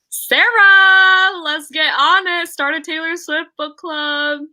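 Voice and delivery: loud, high holler with a stretched name; excited calling out. Real, not acted. Host urging Sarah to start a book club, excited holler. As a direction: urge a friend on, high and excited.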